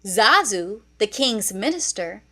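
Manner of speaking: usual speaking voice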